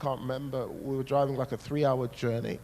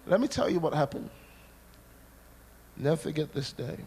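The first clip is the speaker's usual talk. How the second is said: ordinary talk, nothing marked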